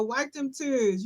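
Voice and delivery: lightly voiced parent, high, soft and slow; slow, softened mock parental order; scolding parent. Parent imitated after a whipping, lightly scolding. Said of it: "Our parents are like".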